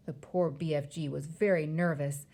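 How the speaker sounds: usual speaking voice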